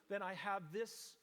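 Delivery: ordinary delivery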